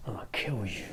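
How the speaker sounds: soft, hammed-up murderous mutter; a joking menacing hush; 1 mock menacing whisper. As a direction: whisper a comic death threat.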